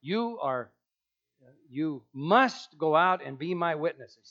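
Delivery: a firm mock command. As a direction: bark it as a command. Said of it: "He did not say".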